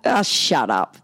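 low, blunt brush-off; retold dismissive child. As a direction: dismiss Mum's tears, unimpressed. Son's blunt retort to pretend crying.

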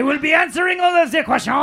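put-on high, loud, rushed French-accented boast; over-excited French dog. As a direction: work yourself up into an excited shout.

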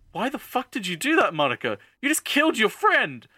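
high-pitched, incredulous scolding; exasperated outrage. Retold or imitated. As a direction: scold them in disbelief, voice leaping up. Speaker's outraged reaction to the character.

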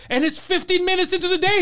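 high mock exasperation with a laugh; imitated fed-up worker. Shop worker complaining early in the day, high mock exasperation. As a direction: pitch it up in comic disbelief. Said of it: "they're fighting".

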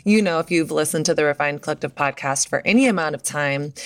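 ordinary talk, nothing marked

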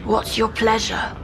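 hoarse, grating shopkeeper voice; raspy voice. Acted. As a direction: rasp out the merchant's line. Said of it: "spoke in a raspy voice".

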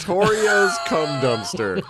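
words squeezed through laughter; laughing. Real, not acted. Laughing repeat of joke name.

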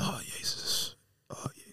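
hushed, breathless Oh, jezus; stunned whisper, fading away; shocked whisper. Real, not acted. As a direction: whisper it in dismay. Shocked reaction to near assault.

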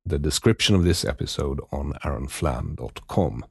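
low, steady sign-off read; podcast outro. Acted. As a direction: read the closing credits.

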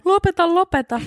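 high mock yell through a laugh; imitated shouting. Friend seeing people run at her, laughing mock yell. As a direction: mimic a friend's squealed protest, amused. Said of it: "Ja sä huusit".

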